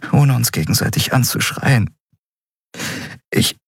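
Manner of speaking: high, fast, tearful voice; pushing through tears; voice breaking. Acted. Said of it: "ihre Stimme brach".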